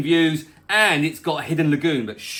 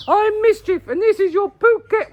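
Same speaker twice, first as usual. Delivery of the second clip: much higher, louder cartoon voice; squeaky character voice; 5 dB louder than usual. Pet character introducing the weather, high and squeaky. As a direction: pipe up like a cheeky little pet. Restart in the pet's voice.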